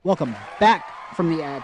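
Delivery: loud, hyped greeting to chat; loud welcome back. Real, not acted. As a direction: call out to the stream as it returns.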